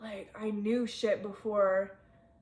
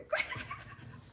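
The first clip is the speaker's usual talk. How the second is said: high laugh; 7 dB quieter than usual. Real, not acted. Laughs at own Leo joke.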